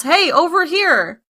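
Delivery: half-hearted retold yell; mock yell. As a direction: imitate calling someone over, without really shouting. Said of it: "lights up flares and yells".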